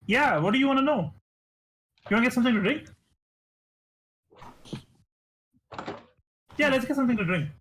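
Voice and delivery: high, quick and bubbly host offering drinks; eager dwarf host. Acted. GM as Pim offering the visitors a drink, high and bubbly. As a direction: play an overeager host rushing to be friendly.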